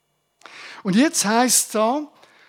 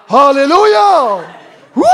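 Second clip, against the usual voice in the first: jubilant shout; 3 semitones higher, 10 dB louder than usual. Acted. Preacher to congregation, shouting joyful praise. Preacher exults over God's voice.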